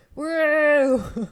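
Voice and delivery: loud yell that collapses into laughter; failed yell into laugh. Acted. Trying a drunk guy's yell, bursting out laughing. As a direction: go for a big yell in his voice and crack up. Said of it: "Can't do his voice and yell".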